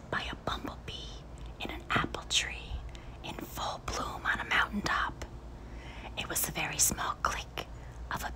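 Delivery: soft hushed storytelling whisper; whispered narration. A narrator's reading. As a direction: whisper the scene as if it is tiny and far away. Whispers the tiny click.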